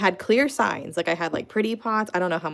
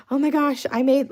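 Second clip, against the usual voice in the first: imitating mom's excitement, pitched up and louder; excited mom; 8 semitones higher, 5 dB louder than usual. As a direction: gush like a thrilled mom with news. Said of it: "she's all excited".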